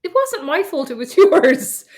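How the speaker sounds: laughing. Real, not acted. Laughing accusation.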